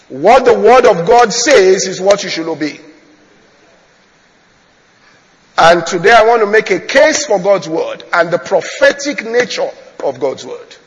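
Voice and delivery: loud, emphatic pulpit voice; forceful preaching. Real, not acted. Preacher warns congregation against judging faith by feeling, forceful preaching. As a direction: proclaim each phrase with weight.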